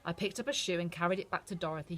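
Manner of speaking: usual speaking voice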